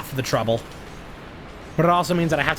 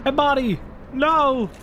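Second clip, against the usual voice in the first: much higher, sharp alarmed cry; startled shout; 11 semitones higher than usual. Real, not acted. Streamer crying out as his character drops, startled. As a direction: yelp it as things go wrong. Sudden alarm during play.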